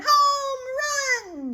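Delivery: very high, loud joyful cry; boy yelling. A narrator's reading. Boy cheering the home run, high loud yell. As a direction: scream it out in triumph. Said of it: "yells the boy".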